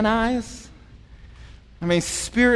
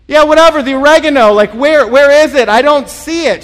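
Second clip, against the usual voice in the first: loud, higher impatient yell; exasperated shouting; 5 semitones higher, 11 dB louder than usual. Retold or imitated. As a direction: shout it out, fed up. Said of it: "And I'm like".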